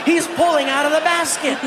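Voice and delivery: ordinary talk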